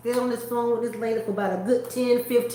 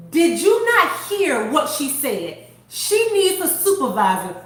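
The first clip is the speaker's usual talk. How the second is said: loud, emphatic retold scolding; going off; 5 dB louder than usual. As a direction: imitate an outraged customer defending someone. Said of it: "she was going off on my behalf".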